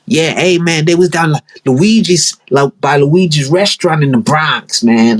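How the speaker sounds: a bad put-on Bronx mob voice; mock mafia accent. Youngster reporting the hit, mock Bronx mafia accent. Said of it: "I don't know what accent that was".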